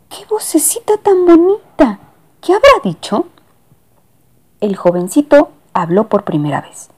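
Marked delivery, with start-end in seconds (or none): 0.1-3.3 high cooing lady voice; gushing lady. Acted. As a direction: coo in delight at the cat. Lady gushes over cat.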